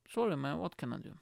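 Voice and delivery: ordinary talk, nothing marked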